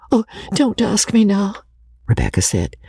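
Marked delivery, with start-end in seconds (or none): 0.1-1.6 higher, distressed begging; tearful plea. A narrator's reading. Weeping Rebecca begging the old lady, tearful. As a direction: plead weepily to be spared.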